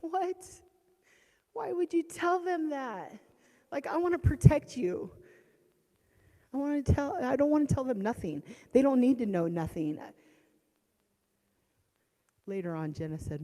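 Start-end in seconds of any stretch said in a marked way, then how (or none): 0.0-10.0 high, loud disbelief; exasperated outburst. Retold or imitated. Mother reacting to son's confession, high and loud. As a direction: cry out in protest. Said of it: "reacting to son's confession".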